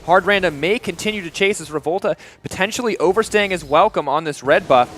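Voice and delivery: loud, high esports casting; play-by-play. Real, not acted. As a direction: call the fight as it happens. Live play-by-play of a teamfight.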